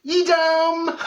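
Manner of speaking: high, loud Edam; comic high Edam; cheesy grin answer. Retold or imitated. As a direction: beam Edam at the camera. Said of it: "And I'm like".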